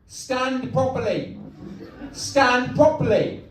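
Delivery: slow, insistent mock order; imitated scolding girlfriend. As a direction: scold with each word drawn out. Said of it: "my girlfriend shouts out".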